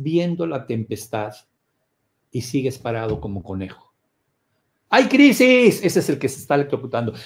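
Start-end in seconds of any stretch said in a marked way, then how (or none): 4.9-5.8 loud, high alarmed cry; hammed-up panicked alarm; mock panic shout. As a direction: shout the bad news like a panicked person. Acted panic over crisis.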